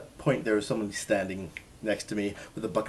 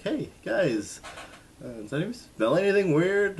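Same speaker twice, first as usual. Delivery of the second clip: jokey quip; 11 semitones higher, 6 dB louder than usual. Retold or imitated. Joking to stunned crowd after burn.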